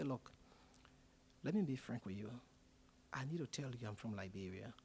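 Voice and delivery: soft, low, confiding; quiet confession. Retold or imitated. Speaker quietly confides to the hotel man that he's from Liberia.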